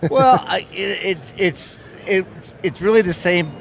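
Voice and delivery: halting, stumbling restarts; stuttering. Real, not acted. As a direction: stumble over the opening words. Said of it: "I'm sorry for stuttering".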